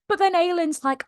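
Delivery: ordinary talk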